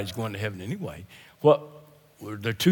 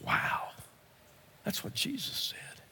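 whispered, much softer than usual; hushed awe; 9 semitones higher, 13 dB quieter than usual. Real, not acted. Preacher marvelling at what Jesus said, hushed. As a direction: let it drop to an awed whisper. Awed pause after quoting Jesus.

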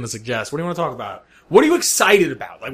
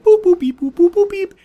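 loud, high electronic boops and beeps; high-pitched beeping; 14 semitones higher, 6 dB louder than usual. Retold or imitated. Player entering the house's secret sequence, high imitated beeps.

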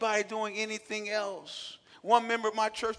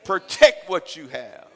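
slow loud exhortation; 3 semitones lower, 14 dB louder, slower than usual. Real, not acted. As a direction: punch each word, slow. Preaching exhortation.

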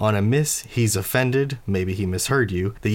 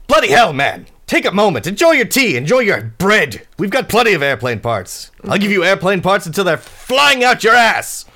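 loud, high-pitched scolding; exasperated rant; 11 semitones higher, 8 dB louder than usual. Acted. Quint tells off Fads over airplane parts, exasperated rant. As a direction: rail at an impatient guest, building in heat.

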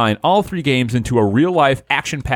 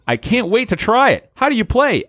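put-on high, eager little boy; eager kid voice; 9 semitones higher than usual. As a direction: ask it like an impatient kid. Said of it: "asked Fro".

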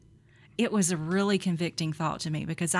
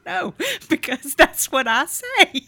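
laughing reply; 11 semitones higher, 8 dB louder than usual. Retold or imitated. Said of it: "And I was like".

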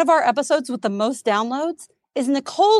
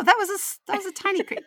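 surprised; 11 semitones higher, 4 dB quieter, faster than usual. Real, not acted. Reacts to door creak.

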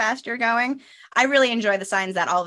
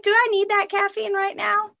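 much higher, mock-tempted inner voice; high questioning self-talk; 14 semitones higher than usual. Speaker eyeing the last cup of coffee, high mock-tempted voice. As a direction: ask yourself, pitched way up, tempted.

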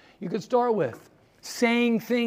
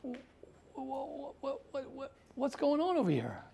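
bewildered stammer; 6 semitones higher, 9 dB quieter than usual. Retold or imitated. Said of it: "I'm saying to myself".

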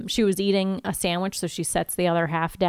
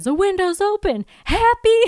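high, bitchy jab that gets louder; mock passive-aggressive snipe, pitched way up; 12 semitones higher, 4 dB louder than usual. Kathy needles Buffy after breaking the window, passive-aggressive and bitchy. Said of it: "passive-aggressive, bitchy".

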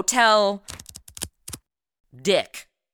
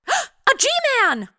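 surprised. Acted. Gasps at the detective's arrival.

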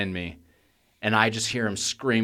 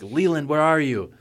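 higher-pitched searching call; retold calling out; 6 semitones higher than usual. Parent calling from the front of the house, mock yell. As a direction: call out as if searching, without really shouting.

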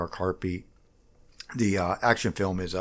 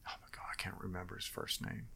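whisper; 19 dB quieter, faster than usual. Real, not acted. Forgets a name mid-story.

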